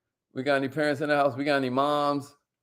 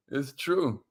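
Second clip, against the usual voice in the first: ordinary talk, nothing marked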